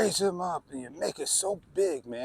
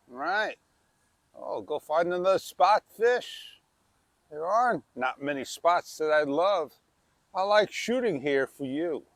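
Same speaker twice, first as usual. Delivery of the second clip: ordinary talk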